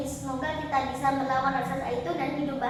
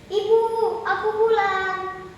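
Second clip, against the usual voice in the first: raised, loud, drawn-out homecoming call; calling out home; 5 semitones higher, 4 dB louder, slower than usual. Acted. Girl returns safely to her mother, calling out. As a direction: sing out the arrival, stretching the last word.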